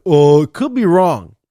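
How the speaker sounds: loud mock-official brush-off; mocking imitation. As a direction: parrot their excuse with exaggerated scorn. Mocking the test staff's answer.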